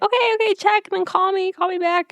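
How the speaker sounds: bubbly high-pitched caller; excited high Suki. Retold or imitated. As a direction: chirp it out like an eager friend. Suki voiced in retelling.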